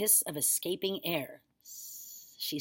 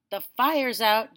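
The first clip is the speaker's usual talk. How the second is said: loud, slow proud call; boy proudly declaring the fire out; proud announcement; 5 semitones higher, 11 dB louder, slower than usual. A narrator's reading. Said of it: "George announced proudly".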